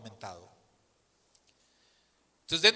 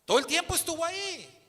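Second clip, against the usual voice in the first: indignant surprise; 13 semitones higher, 4 dB louder than usual. Real, not acted. Indignant reveal of supervisor.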